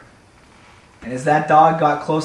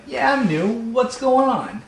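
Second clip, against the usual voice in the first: much higher-pitched newcomer cat voice; new cat; 9 semitones higher, slower than usual. Acted. New cat answering the local cats, high friendly voice. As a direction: answer high, stretching the last phrase slowly. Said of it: "And the new cat would be like".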